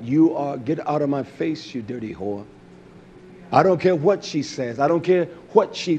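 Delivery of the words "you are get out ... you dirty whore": imagined scolding of daughter. Pretend. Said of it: "I would tell her just like that".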